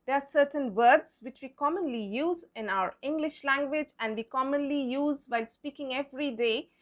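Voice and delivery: ordinary talk, nothing marked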